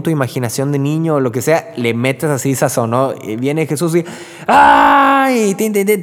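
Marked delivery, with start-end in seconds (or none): 4.5-5.5 sudden high frightened shriek; scared cry. Retold or imitated. Telling children how the disciples screamed, a sudden scared cry. 5.5-6.0 spooky music sound. Pretend. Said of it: "toda la música".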